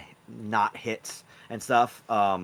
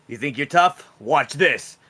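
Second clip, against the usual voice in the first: louder, mildly cocky challenge; showing off; 5 dB louder, slower than usual. Acted. Lion fighter to his rival Croc, showing off. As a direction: throw down a lightly acted dare. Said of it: "he's trying to, like, show off".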